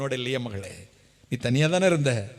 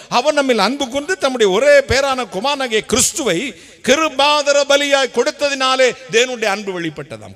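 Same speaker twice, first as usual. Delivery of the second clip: loud, high rhetorical preaching; fervent preaching; 5 semitones higher, 5 dB louder than usual. Acted. Preacher to congregation, loud rhetorical questions. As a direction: hammer each question home.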